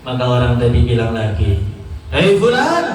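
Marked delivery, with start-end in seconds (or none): 2.1-2.9 calling out to a patient. Acted. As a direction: hail him high, rising sharply. Tabib memanggil pasien lagi.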